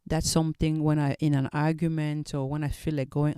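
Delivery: ordinary delivery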